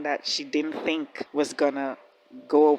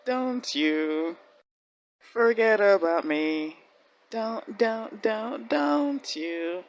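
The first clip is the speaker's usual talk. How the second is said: imitating Mariana; 4 semitones higher, much slower than usual. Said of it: "Mariana is saying to Anne".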